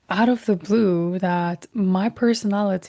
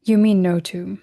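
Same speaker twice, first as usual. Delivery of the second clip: normal talking voice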